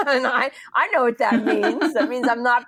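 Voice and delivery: real laughter through the words; laughing. Real, not acted. Telling her stalled labour, laughing through it. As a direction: laugh it out, a bit rueful.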